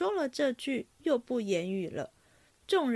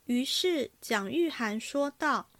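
ordinary delivery

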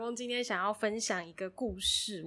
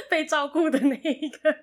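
laughing; 6 semitones higher, 10 dB louder than usual. Real, not acted. Laughing while talking.

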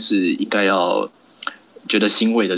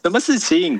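Singaporean accent; 8 semitones higher than usual. Shown as a demonstration. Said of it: "有时候一个不小心还是会出现这些".

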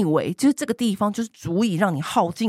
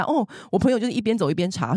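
ordinary talk

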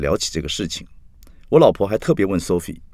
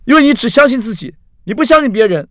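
very high, loud retort; shouted accusation; 8 dB louder than usual. A narrator's reading. Axi snaps back at Carrie after a silence, loud accusation. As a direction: hurl the accusation at full voice.

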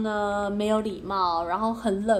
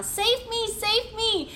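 mock plea for help; 11 semitones higher, slower than usual. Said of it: "你绝对不要讲".

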